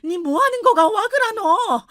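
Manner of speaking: put-on old Gyeongsang grandmother shocked by the slap. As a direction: cry out in startled Gyeongsang dialect. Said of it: "할머니의 외마디가 크게 찢어질 듯".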